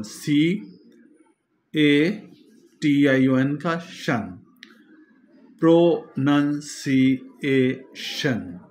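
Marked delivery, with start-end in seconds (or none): 0.0-3.6 सीएटीआईओएन spelled out very slowly, letter by letter. Shown as a demonstration. Teacher spelling the word for students, letter by letter. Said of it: "दूसरा syllable". 5.6-8.6 प्रोनंसिएशन said very slowly, each syllable apart. Shown as a demonstration. Teacher sounding out the word for the class, syllable by syllable. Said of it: "इसमें पांच syllables".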